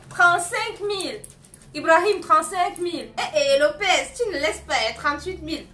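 high, loud bid calls; animated auction holler; excited auctioneer. Real, not acted. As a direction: shout out the new bids to the crowd. Auctioneer calling out rising bids.

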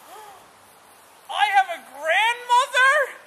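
high, loud, thrilled exclamation; excited. Acted. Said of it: "he was so excited".

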